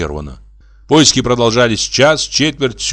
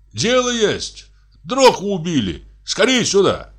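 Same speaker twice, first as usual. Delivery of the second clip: high-pitched call across the fields; 9 semitones higher than usual. A narrator's reading. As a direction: summon the others from afar. Shouted exchange between distant groups. (высокий окрик издалека)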